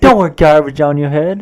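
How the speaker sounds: quick, high mock Australian accent; stupid Australian accent. Co-host quoting Justice telling someone off, stupid Australian accent. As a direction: rattle it off as a silly Aussie. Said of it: "In this stupid Australian accent".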